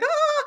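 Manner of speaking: high, loud cry of fright; hammed-up startled scream; mock frightened scream. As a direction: shriek as if spooked by a ghost. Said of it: "they're freaking out".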